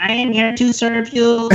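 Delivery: slow, stiff robot voice. Pretend. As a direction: speak like a machine serving you. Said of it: "That's like my fake robot voice".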